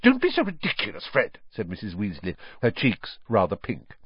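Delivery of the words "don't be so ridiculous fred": high, fast, embarrassed scolding; flustered rebuke. A narrator's reading. Mother with pink cheeks rebuking her son, flustered. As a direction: brush off the tease hurriedly.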